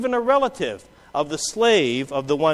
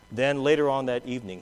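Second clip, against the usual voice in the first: ordinary talk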